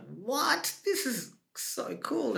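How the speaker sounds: high-pitched, drawn-out disbelief; thrilled, high squeal of wonder without extra volume; amazed delight. Retold or imitated. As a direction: marvel at it in a high, stretched voice. Acts out delighted reaction to auditions.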